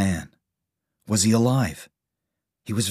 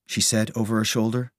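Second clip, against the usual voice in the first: usual speaking voice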